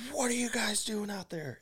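very high mock yell, held back in volume; imitated frantic woman. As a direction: mimic her losing it in a high falsetto. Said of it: "this lady is losing her mind".

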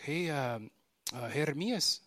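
hesitant, lightly voiced Mexican worker calling the boss by name; Mexican accent. A worker asking his boss for the hunter's attention, lightly imitated Mexican accent. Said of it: "It was four Mexican dudes".

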